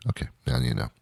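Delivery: breathy unvoiced aside to the audience; whispered aside. Explaining coins to the kids, in a whisper. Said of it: "aside to kids".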